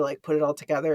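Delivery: ordinary delivery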